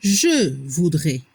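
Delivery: "je voudrais" slow and low, ending in E; pronunciation model. Shown as a demonstration. Teacher showing learners the conditional, slow low "je voudrais" ending in E. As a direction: draw out "je voudrais", AIS sounded as E. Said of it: "La pronunciación".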